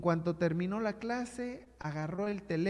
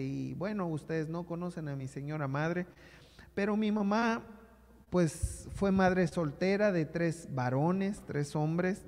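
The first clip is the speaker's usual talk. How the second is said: ordinary talk, nothing marked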